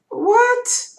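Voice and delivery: high-pitched disbelieving squeak, mock shock; stunned what. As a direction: shoot the voice way up in disbelief. Told copay jumped to five thousand.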